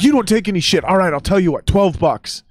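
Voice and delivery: mock cabbie striking a deal; driver voiced. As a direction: play the tough cabbie making a deal.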